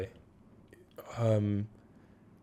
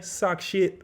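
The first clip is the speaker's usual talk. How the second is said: a mock rude phone retort, pitched up high; imitating housemate; 9 semitones higher than usual. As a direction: spit it out like a cheeky insult down the phone. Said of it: "I just hear him on the phone going".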